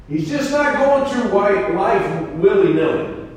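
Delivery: preaching. Acted. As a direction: press the lesson home to the congregation. Preacher drives the point home.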